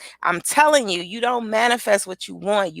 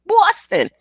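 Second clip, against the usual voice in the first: high, loud pop on Boston; changed voice; 12 semitones higher, 5 dB louder than usual. Shown as a demonstration. Showing her Boston voice, high and loud. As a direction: punch it out in a raised voice. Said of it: "my voice when I say that word".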